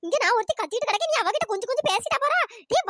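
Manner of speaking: ordinary talk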